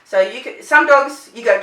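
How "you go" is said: usual speaking voice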